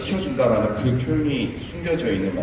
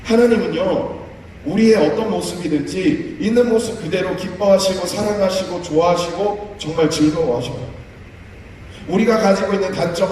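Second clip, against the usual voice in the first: ordinary delivery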